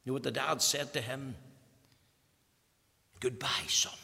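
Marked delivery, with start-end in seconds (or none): 3.2-3.9 breathy whispered farewell; barely voiced, a father's last words; dying whisper. Dying father whispering goodbye to his son.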